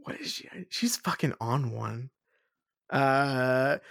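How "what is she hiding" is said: whisper. Acted. Echoed as a whisper.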